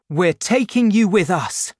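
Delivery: don't take no for an answer; insistent. A narrator's reading. Marius insisting.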